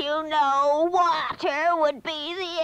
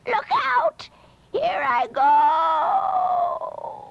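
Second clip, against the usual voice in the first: very high, drawn-out shrieking witch cry; final cry; much slower than usual. A narrator's reading. The witch cries out as she melts away, high drawn-out shriek.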